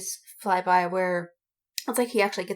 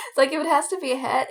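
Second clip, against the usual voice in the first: ordinary talk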